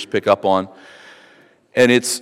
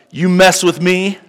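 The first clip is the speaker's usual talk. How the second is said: a hint of a vengeful boaster, higher and slow; Lamech; 4 semitones higher, slower than usual. Preacher voicing Lamech's boast to his wives, mildly menacing. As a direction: threaten slowly, as a menacing braggart. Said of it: "he's like".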